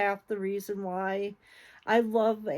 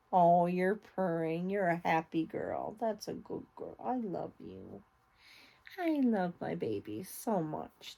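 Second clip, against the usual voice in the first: very soft, tender pet talk; 6 dB quieter than usual. Real, not acted. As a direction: murmur lovingly to the cat. Petting her purring cat.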